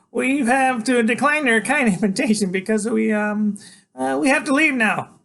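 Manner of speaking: high, loud, polite nervous voice; chattery high. A narrator's reading. Freak turns down Iggy's invitation, chattery high and nervous. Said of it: "his voice is chatterly high".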